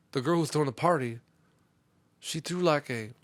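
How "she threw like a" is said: ordinary talk, nothing marked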